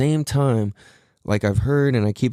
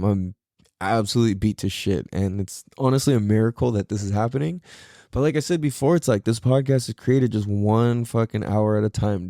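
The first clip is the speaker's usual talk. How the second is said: ordinary talk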